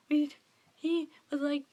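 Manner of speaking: ordinary talk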